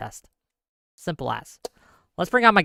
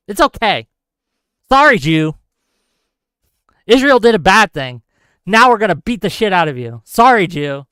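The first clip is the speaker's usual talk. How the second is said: sarcastic mock; 2 semitones higher, 7 dB louder than usual.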